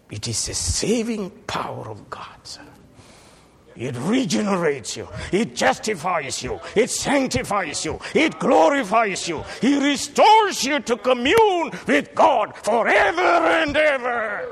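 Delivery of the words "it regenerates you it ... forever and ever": builds to a high, loud shout; building preaching. Real, not acted. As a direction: climb phrase by phrase to a cry.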